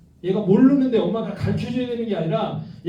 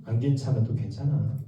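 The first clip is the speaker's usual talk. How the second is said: softer, lower and tender; gentle reassurance; 8 semitones lower, 4 dB quieter than usual. Shown as a demonstration. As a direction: say it like a soft embrace. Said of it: "이렇게 얘기해 주시면 돼요".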